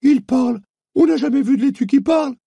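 very high, excited squeak of a small mole; mole exclaiming. A narrator's reading. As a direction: cry out in amazement. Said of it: "s'écria la taupe". (la taupe s'écrie, voix très aiguë)

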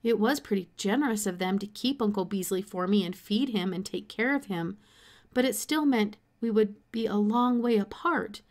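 ordinary delivery, nothing marked

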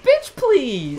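high loud sassy bitch please. Pretend. Host acting out the face, high loud sass. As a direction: snap it like a diva.

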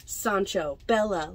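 usual speaking voice

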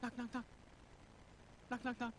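soft, small spoken knocks; quiet spoken knocking, barely there; timid little knock. Retold or imitated. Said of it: "you give this little knock".

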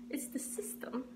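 hushed conspiratorial whisper. Pretend. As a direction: breathe it like a secret. Conspiracy talk about the system.

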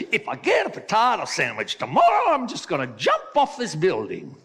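high, loud mock-Irish threat; exasperated joke Irishman, poor brogue; bad Irish accent. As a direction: rant it in a badly faked Irish accent. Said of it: "Irish accent".

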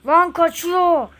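shocked loud exclamation; startled shout. Real, not acted. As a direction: yell as he gets away. Friend sees captive run off.

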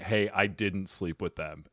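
quiet, slow quote; gently imitating an earnest confession; soft imitated denial. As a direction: say it low and unhurried. Quotes Pablo's honest denial.

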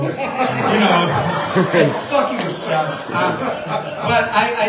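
laughing through the words; breaking into laughter mid-line. Real, not acted. Laughing at own dark joke.

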